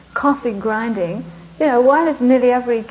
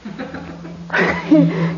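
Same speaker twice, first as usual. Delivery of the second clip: inhale a tempting smell deeply; long savouring sniff. Acted. Passer-by catches coffee from a vent, long blissful sniff.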